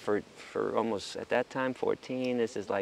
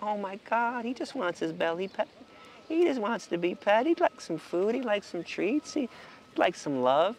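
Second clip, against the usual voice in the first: lightly acted wonder, pitched higher; touched amazement; 7 semitones higher than usual. Visitor petting a farm animal, mildly acted tender amazement. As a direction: marvel in a higher voice at a sweet discovery.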